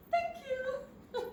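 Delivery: high, laughing thank you; giggling thanks. Acted. Woman accepting the sandwich from the stranger, giggling.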